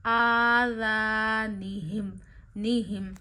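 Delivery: "आ" held long and chanted in "आ ज़ा निहिम"; stretched alif. Shown as a demonstration. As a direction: draw out "आ" a finger's length, slowly. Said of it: "अलिफ को एक उंगली जितना खींचिए". ("आ" को एक उंगली जितना खींच कर पढ़ें)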